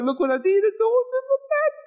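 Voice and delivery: very high, excited squeal; hammed-up delight, climbing sky-high; giddy showing off. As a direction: squeal with mock pride in your handiwork. Showing off the finished work.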